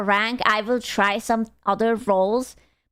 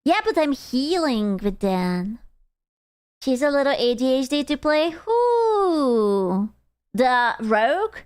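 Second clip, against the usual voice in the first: ordinary delivery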